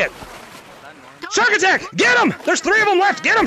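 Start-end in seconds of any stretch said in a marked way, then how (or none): 1.3-3.5 high, urgent rallying cry; excited attack call. Real, not acted. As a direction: send the team charging, pitch way up. Launching the chase.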